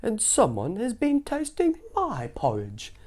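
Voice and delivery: a fussy mama bear; slow high motherly bear. Acted. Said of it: "said the mama bear".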